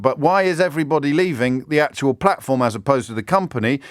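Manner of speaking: usual speaking voice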